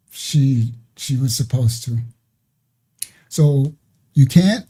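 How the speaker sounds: ordinary talk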